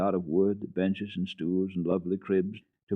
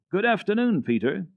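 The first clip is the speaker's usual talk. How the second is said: put-on bright high little girl; a happy hello; cheerful child greeting; 12 semitones higher, 4 dB louder than usual. A narrator's reading.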